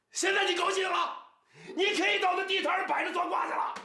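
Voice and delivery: shrill angry rebuke; furious shout. Acted. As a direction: explode at her in blame. Father keeps scolding.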